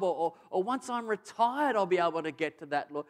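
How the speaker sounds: lightly mocking believer's excuses. Mimicking excuses to God.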